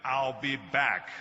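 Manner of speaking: slow, loud movie catchphrase; Terminator. Acted. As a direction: deliver it as the famous robot threat, drawn out. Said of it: "when I did Terminator".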